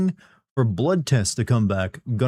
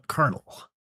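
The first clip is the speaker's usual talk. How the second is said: softer, higher soldier voice; Solid Snake; 3 semitones higher, 6 dB quieter than usual. Pretend. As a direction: quietly call the colonel. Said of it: "I can't really nail it right without seeing it on screen".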